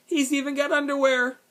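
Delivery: high, loud schoolboy jeer; jeering boy. A narrator's reading. Martin to the other boy about the rabbit, loud jeering. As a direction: crow it high and loud, mocking.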